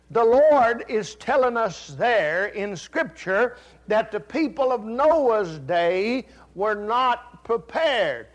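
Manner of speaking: loud, slow preaching; fervent sermon. Acted. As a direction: drive the warning home to the church. Preaching at full force.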